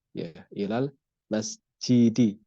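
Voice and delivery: masjidi slow with the final i held; long vowel. Shown as a demonstration. Teacher models the reading for students, slow. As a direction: draw out ilal masjidi slowly. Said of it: "Kemudian zahabtu ilal masjidi".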